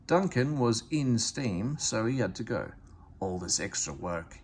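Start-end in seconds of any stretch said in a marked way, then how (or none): 3.2-4.4 grumbling. A narrator's reading. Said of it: "he grumbled".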